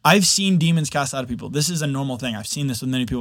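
usual speaking voice

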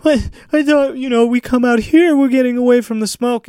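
high dopey clueless voice; dumb clueless voice. Retold or imitated. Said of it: "walking around like".

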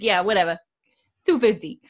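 hammed-up Boston cabbie; bad Boston accent. Cabbie brushes off Roger, bad Boston accent. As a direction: put on a terrible Boston accent. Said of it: "gonna be the worst Boston accent ever".

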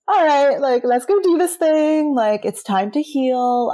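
high, loud pep talk to herself; rally yourself, pitched well up; eager self-quote. Retold or imitated. Acts out her eager first-ceremony mindset.

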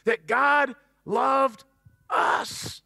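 that God loved us, slowed and stretched, us almost breathed; slow emphatic stress. Shown as a demonstration. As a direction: lean hard on loved and drop to a breath on us. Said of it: "but listen".

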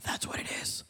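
hushed whisper. Retold or imitated. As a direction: breathe it out with no voice. Friend whispers in worship.

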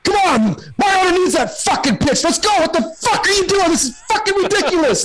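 high-pitched acted tirade; cussing out the umpire. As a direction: berate the umpire in a high, strained voice. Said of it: "he's cussing out the umpire".

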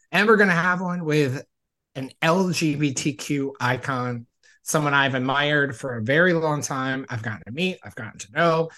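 loud, upbeat show-host delivery; podcast host intro. Acted. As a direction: pump up the welcome like a host. Podcast host opening the episode.

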